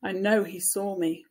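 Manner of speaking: usual speaking voice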